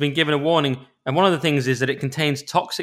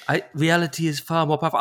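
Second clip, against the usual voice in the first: Herzog impression. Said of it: "I can't do it".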